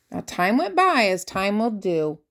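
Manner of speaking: plain reading voice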